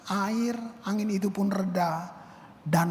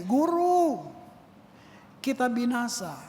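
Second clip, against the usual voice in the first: frightened cry; 4 semitones higher, slower than usual. Retold or imitated. Disciples crying to their teacher, panicked. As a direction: cry out like sinking in a storm. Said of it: "Itu takut".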